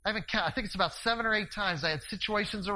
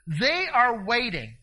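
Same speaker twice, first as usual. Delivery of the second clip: emphatic preaching; 4 semitones higher, 6 dB louder, much slower than usual. Real, not acted.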